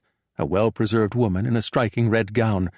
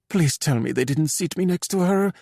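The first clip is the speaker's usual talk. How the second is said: lightly voiced woman, higher pitch; a mild higher-pitched plea; pleading; 7 semitones higher than usual. A narrator's reading. Chiara pleads not to be seated there.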